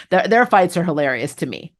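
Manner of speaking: usual speaking voice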